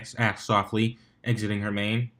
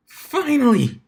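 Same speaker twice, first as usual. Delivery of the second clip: high, loud cry of relief; a sudden loud, high exclamation; excited outburst; 12 semitones higher, 6 dB louder, slower than usual. Acted. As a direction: burst out with it at last. Spitfire bursts out after sigh.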